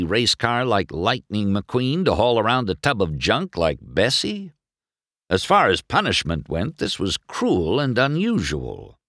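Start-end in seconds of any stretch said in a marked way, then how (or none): none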